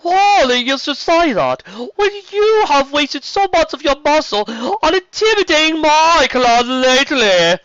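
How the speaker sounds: loud, high, imperious grande dame; serpent queen. Acted. Queen rebuking the dragon for intimidation, haughty and loud.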